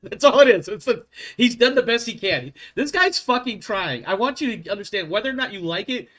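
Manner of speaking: emphatic plea. Real, not acted. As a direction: insist this poor guy is truly trying.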